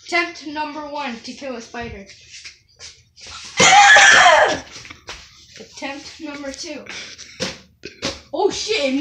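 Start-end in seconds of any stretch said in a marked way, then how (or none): 3.2-5.2 loud startled coughing laughter; coughing shriek laugh. Real, not acted. Spider jumps, coughing laugh.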